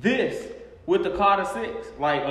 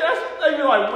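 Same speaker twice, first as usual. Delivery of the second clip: ordinary talk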